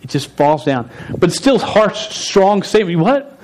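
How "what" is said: shocked what. Retold or imitated. Voicing a shocked believer.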